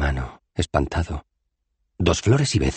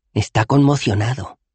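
ordinary delivery, nothing marked

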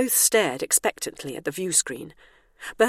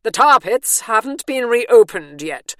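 loud, haughty and indignant; haughty vehemence; 5 semitones higher, 10 dB louder than usual. A narrator's reading. Matron scolds Bernice in the closed tar pit, haughty and loud. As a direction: spit out every word with disdain. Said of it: "brimming over with haughty vehemence".

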